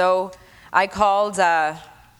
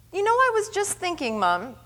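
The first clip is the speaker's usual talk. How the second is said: son's voice; 6 semitones higher than usual. Retold or imitated. Son voiced in story.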